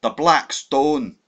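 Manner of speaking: loud, slow dramatic cry; Hannay crying out the enemy's name; cried out. A narrator's reading. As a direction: declare it slowly and loud. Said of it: "I cried".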